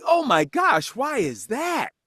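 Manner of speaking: high-pitched retold exclamation; mock high energy. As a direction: react with over-the-top eagerness. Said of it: "With that energy".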